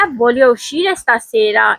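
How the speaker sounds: "voglio uscire stasera" said very slowly, uscire as oo-SHEE-reh; teacher modelling Italian slowly for repetition; slow Italian model sentence. Shown as a demonstration. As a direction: model each syllable slowly. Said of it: "दूसरा जुमला है".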